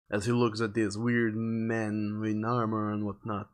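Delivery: ordinary delivery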